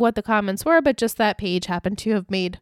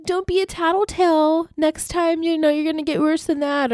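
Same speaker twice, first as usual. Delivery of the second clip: mock threat; 7 semitones higher than usual. Paige's threat voiced.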